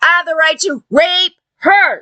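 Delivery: mocking impression of belligerent entitlement; mimicked defiant men. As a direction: imitate a man insisting, word by word. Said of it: "They're like".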